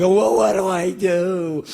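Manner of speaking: put-on high, slow, childlike worry; worried little kid. Young son asking his mom for help, worried. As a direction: ask it like a helpless small boy.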